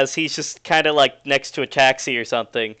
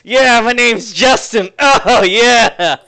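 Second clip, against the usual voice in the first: loud, slow, higher whooping cry; ecstatic yell; 8 semitones higher, 8 dB louder, much slower than usual. Acted. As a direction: howl it out in wild delight. NPC whips himself, yells.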